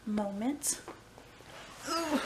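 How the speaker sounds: ordinary delivery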